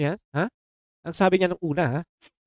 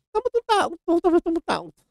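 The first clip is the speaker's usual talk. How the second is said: sarcastic mock praise; 12 semitones higher than usual. Sarcastic high mock agreement.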